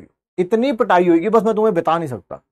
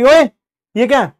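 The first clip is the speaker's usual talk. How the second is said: mock call up to a window; 5 semitones higher, 6 dB louder than usual. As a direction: call out to the boy upstairs. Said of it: "उसने मुझे आवाज मारी". (ऊपर खिड़की की ओर आवाज़ लगाना)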